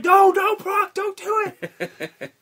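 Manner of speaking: high, loud, slow panicked pleading; panicked announcer. Retold or imitated. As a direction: beg Brock in a high panic. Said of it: "the announcer's like".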